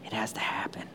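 hushed, breathy emphasis; whisper. Real, not acted. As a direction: drop to an urgent whisper. Intense hushed emphasis in sermon.

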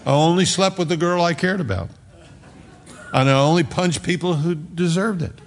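high, ringing pulpit voice; a pastor preaching hard to his church; preaching at full force. Acted. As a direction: drive it home to the congregation.

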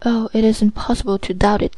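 plain reading voice

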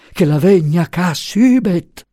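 high, drawn-out wailing plea; distraught plea. A narrator's reading. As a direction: beg her to come, wailing slowly.

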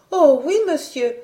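high eager maid; lightly voiced young maid. A narrator's reading.

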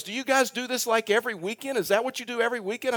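high-pitched curious newcomer; amazed questioning. Retold or imitated. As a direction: imitate an astonished visitor asking eagerly. Visitor's amazed questions, acted out.

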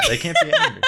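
high squeaky laughing repeat. Acted.

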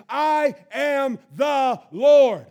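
slow, very loud shout of I am the Lord; climactic shout. Acted. God's final declaration to Moses, slow roaring shout. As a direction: stretch and roar each word.

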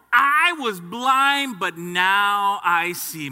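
loud, slow proclamation; preaching climax. Acted. Preacher proclaiming to the congregation, loud and slow. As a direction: proclaim it to the whole church.

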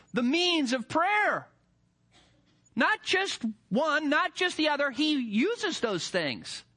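high, ringing preacher's cry, rising with each phrase; preaching at full force. Acted. Pastor to church on God's means, preaching at full force. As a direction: preach it out to the whole congregation.

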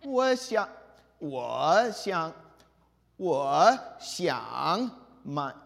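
"我想买" drawn out with exaggerated dips; stumbling through third tones. Shown as a demonstration. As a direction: restart "我想" loudly, each tone laboriously scooped. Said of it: "Now I'm locked up".

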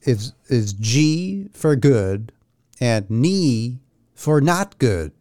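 spelled out slowly, each word apart; slow, careful explanation. Shown as a demonstration. Explaining his slang to cohosts, slowly and deliberately. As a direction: lay out G and ni deliberately. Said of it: "the main ac or abrev that I use".

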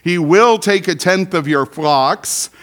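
"will" leaned on, held and loud; stress on WILL. Shown as a demonstration. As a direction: press hard on "will" as a warning. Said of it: "Did you hear my emphasis?".

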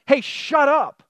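high, loud retold order; mock sharp command. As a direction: playfully yell down the storm. Jesus retold curtly silencing the storm.

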